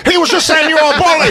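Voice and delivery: high, fast gleeful snitching; mock tattling shout. Acting out friends tattling on him.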